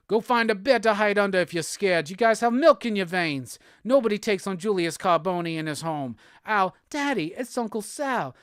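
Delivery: hammed-up British mob boss; theatrical, indignant British accent; mock British accent. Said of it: "Why did he get British all of a sudden?".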